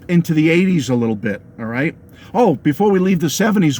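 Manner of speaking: usual speaking voice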